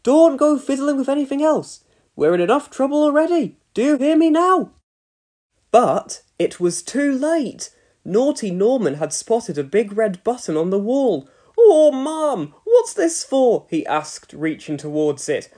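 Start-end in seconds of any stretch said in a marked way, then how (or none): 0.0-4.7 fussing mum. A narrator's reading. Mum warns son. 11.6-13.6 put-on curious boy. A narrator's reading.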